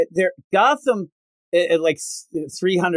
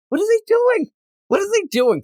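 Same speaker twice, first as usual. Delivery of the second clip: high-pitched incredulous questioning; baffled; 14 semitones higher than usual. Real, not acted. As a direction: ask it in exasperated disbelief. Host baffled by the plot.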